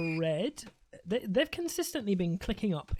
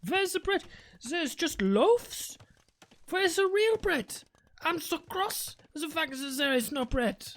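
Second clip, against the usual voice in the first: much higher, outraged fake German accent; mock German accent; 7 semitones higher than usual. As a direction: splutter like a furious German shopper. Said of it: "they were like".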